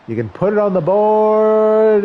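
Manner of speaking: a slow, big play-by-play cry of victory; excited call. Real, not acted. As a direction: sell the win to the crowd, slowly. Calling the game's final result.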